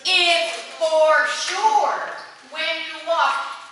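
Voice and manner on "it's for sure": "sure" slow, louder and falling; confident and slow. Shown as a demonstration. Director showing the choir a confident "for sure". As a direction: land "sure" like a certainty. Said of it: "walk having more confidence".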